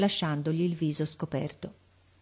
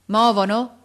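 loud, raised, short query; called-out question; 4 semitones higher, 8 dB louder than usual. A narrator's reading. Officer questions boy in tree, calling. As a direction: call it up to the lookout.

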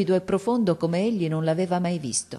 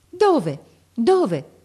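high, loud, urgent cry of Where; boy's desperate shout; 7 semitones higher, 7 dB louder than usual. Acted. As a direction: leap up shouting Dove, much higher and louder. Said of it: "gridò Marco saltando su". (ragazzo che grida Dove? Dove? con urgenza disperata)

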